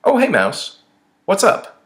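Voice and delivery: high, loud street hello; bright casual greeting. A narrator's reading. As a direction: call out a perky hello to a passing acquaintance.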